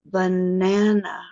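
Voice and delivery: banana, long slow middle syllable; stretched stress. Shown as a demonstration. Teacher modelling a word list for students, lengthened. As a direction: drag out the stressed vowel of Banana. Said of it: "try this first horizontal line with me".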